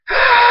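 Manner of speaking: very high, sustained scream. Acted. Thunderstorm in pain as the ground splits, high scream. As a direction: let out a long high scream. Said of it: "Thunderstorm screams".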